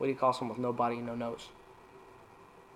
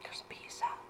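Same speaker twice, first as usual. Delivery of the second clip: whisper; 12 dB quieter than usual. Acted. Whispered punchline.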